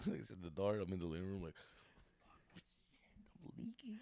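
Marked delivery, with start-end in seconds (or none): 2.5-4.0 very slow, hushed whisper; retold whisper. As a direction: breathe it out secretly.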